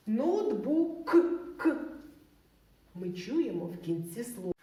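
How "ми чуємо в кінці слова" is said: ordinary talk, nothing marked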